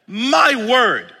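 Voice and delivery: normal talking voice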